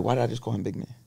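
quiet, musing to himself; hushed, puzzled inner monologue; inner thought. Retold or imitated. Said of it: "right in my head".

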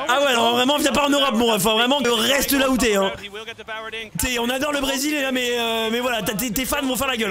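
scolding in a Portuguese-accented French. Shown as a demonstration. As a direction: keep up the put-on Portuguese accent. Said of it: "je sais faire l'accent portugais".

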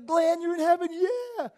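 high, loud elated call to a friend; joyful shout. Retold or imitated. Preacher retelling his dream greets his friend in heaven, joyful shout. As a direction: whoop it out, overjoyed to find them.

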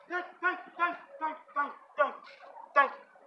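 soft, high, repetitive little thanks; mock grateful homeless man. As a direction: imitate someone thanking over and over in a small high voice. Retells homeless man's repeated thanks.

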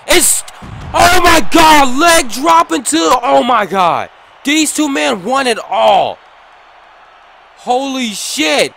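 loud, high, stunned hype; excited shouting. Real, not acted. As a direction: scream the big moment. Wild commentary on big spot.

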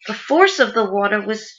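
normal talking voice

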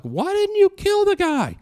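very high, loud incredulous shout; exasperated yell. Retold or imitated. Father yells at his daughter in disbelief. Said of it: "I was like".

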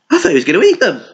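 plain reading voice

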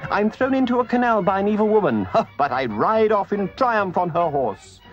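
strut and brag with a laugh; conceited boasting. A narrator's reading. Toad boasts aloud to himself, swollen with conceit. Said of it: "pride and conceit began to swell".

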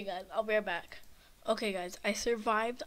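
ordinary delivery

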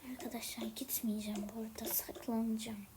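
fast, hushed whisper to the viewers; whispering while hiding. Acted. Saklanacağım. (saklanırken fısıldayarak)

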